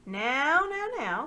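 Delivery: high, loud mock-soothing now now; jolly down the angry prisoners; coaxing slaver. The slaver hushes Caspian, in a loud coaxing sing-song.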